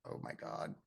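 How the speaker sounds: breathless whispered exasperation; whispering oh my God to herself; whispered aside. Retold or imitated. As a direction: whisper it in disbelief. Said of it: "I was just like".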